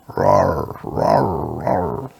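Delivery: low, half-hearted rawr; drawn-out mock growl, kept low; pretend roar. Ghost trying to scare the listener, playful roar.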